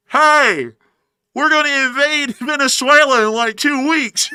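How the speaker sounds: high, loud calling out across the water; megaphone announcement. Acted. Man at Key West calls through a megaphone to Cuba, loud and high.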